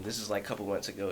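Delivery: ordinary talk, nothing marked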